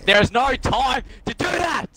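high, slow protesting yell; indignant shout. Real, not acted. Defending himself to his friend, he yells high and slow. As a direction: yell back in frustration. Arguing back in game.

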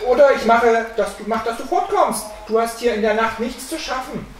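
high, forceful warning; boy calling out. A narrator's reading. Boy warns the figure to leave, high threatening call. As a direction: drive the intruder off, starting loud. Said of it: "rief der Junge".

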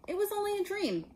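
put-on high boy's voice, defensive; young Harry. A narrator's reading. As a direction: lift the pitch for a small boy protesting. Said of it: "said Harry".

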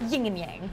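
"Yin and Yang" said as "ying and ying"; mispronounced yin yang. Acted. Eager partner lists famous duos, mispronounced yin yang. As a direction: put an NG on the end of "Yin". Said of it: "You mean yin and yang?".

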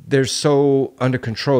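ordinary delivery

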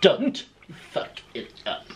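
whispered conspiratorial echo. Real, not acted. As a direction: whisper it back like a secret. Echoing partner's joke warning.

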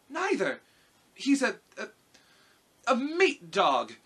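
put-on high, loud, halting girl's voice; reply high, stumbling for the word; little girl. A narrator's reading. Dorothy searches for how to describe Toto, high halting voice. Dorothy answers the lion, groping for words.

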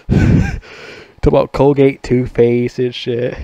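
talking slowly through laughter; words stretched out by giggles; laughing. Real, not acted. Laughing while recalling the Tourette's guy video.

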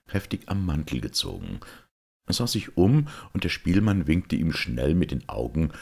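ordinary delivery, nothing marked

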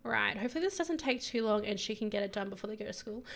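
ordinary talk

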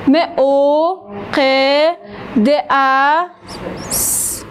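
م او ق د ا س, sounds slow with pauses; sounding out. Shown as a demonstration. Teacher spelling مقدس out for pupils.

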